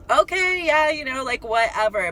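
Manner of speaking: high, loud mock-agreeable opening; dismissive humoring. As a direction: humor them with an exaggerated okay, easing off. Acted humoring reply to his claims.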